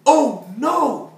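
high, very loud cry of dismay; shout. Acted. Berlioz as the clock chimes eight, dismayed shout. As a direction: shout out in alarm. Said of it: "Berlioz shouted".

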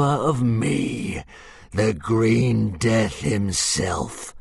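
slow, hissing monster voice; a wounded dragon plotting revenge; menacing dragon hiss. Acted. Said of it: "hissed a little more strongly".

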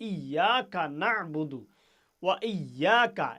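slow and loud with ইয়াকা pressed on the doubled ইয়া. Shown as a demonstration. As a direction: recite with weight on ইয়াকা. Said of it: "এই যে ইয়াকা কইয়া চাপ হবে".

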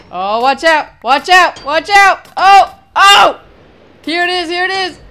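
high frantic shouts; frantic shouting. Real, not acted. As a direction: yell warnings in a panic. Frantic fight.

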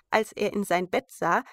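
ordinary delivery, nothing marked

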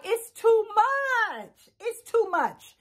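slow, high, whiny imitation of Ingrid; overwhelmed quote. Imitating Ingrid overwhelmed by Brian, slow and whiny. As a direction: stretch it out, mock overwhelmed. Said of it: "she was just like".

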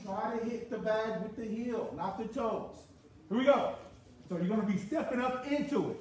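usual speaking voice

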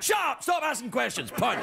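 high, hammed-up snap; comic bully losing patience; mock angry outburst. Acted punching bee exchange.

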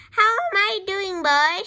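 loud, high grandma shout. A narrator's reading. Grandma shouts down from the roof to George, shouting. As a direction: call down high and loud to a boy. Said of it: "she shouted".

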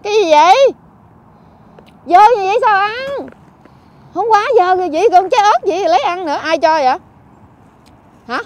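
loud, urgent telling-off; real alarmed scolding. Real, not acted. Helper stops Phong eating dirty food, loud alarmed scolding. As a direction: raise your voice sharply to stop him.

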